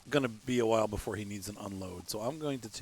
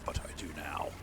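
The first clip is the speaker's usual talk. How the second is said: whisper; 8 semitones lower, 8 dB quieter than usual. Real, not acted. Sly aside after learning.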